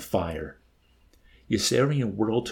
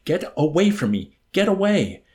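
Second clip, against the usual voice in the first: high, loud cry rising higher; desperate shout; 9 semitones higher, 7 dB louder than usual. A narrator's reading. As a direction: shout to drive someone off. Yossarian shouting while punching Arfie.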